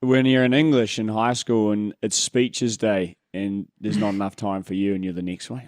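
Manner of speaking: usual speaking voice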